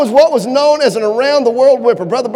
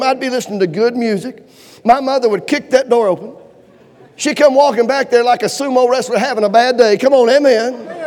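ordinary talk

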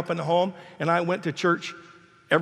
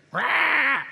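higher mock baby screech; 9 semitones higher than usual. Grandpa imitates his cranky grandbaby's screech. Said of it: "She screamed at me".

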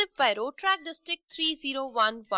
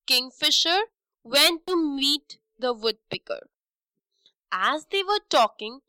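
plain reading voice

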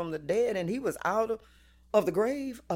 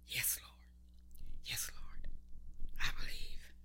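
hushed, whispery surrender; calmed down; 6 semitones lower, 11 dB quieter than usual. Acted. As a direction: breathe it out like a quiet prayer. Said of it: "calmed down and said".

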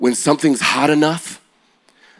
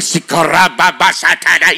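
speaking in tongues; 4 semitones higher, 3 dB louder than usual. Retold or imitated.

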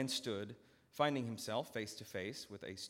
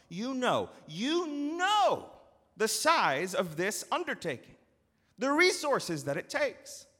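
louder, higher, rising controlled fury; measured anger; 12 semitones higher, 11 dB louder than usual. Acted. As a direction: build the anger but keep it reined. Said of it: "the master continued with measured anger".

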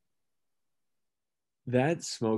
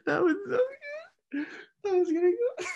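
high squeaky words through laughter; laughing; faster than usual. Real, not acted. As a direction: barely get it out laughing. Laughing hard at impression.

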